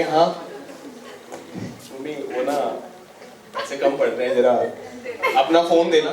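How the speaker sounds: low, hesitant, sweet request to mum; sheepish coaxing. Acted. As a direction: ask mum softly and meekly for a favour. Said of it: "आप बड़े प्यार से बोल रहे हो". (झिझकते हुए, दबी आवाज़ में मनाना)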